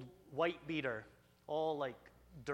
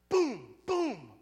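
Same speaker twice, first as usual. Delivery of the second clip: loud, high imitated booms; two sudden punchy booms, falling in pitch; booming impact noises; 9 semitones higher, 8 dB louder than usual. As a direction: mimic rocks crashing down. Imitates rocks landing.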